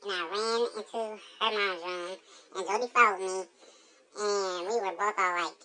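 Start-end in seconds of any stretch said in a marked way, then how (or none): none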